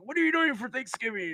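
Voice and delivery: imitating the sergeant major; mock sergeant major. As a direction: play the sergeant major in a light way. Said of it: "I'm like".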